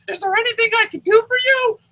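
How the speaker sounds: very high, squeaky mock helper; high-pitched parody of eager service; YouTube employee. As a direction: offer help in an absurd falsetto. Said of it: "Here's a YouTube employee".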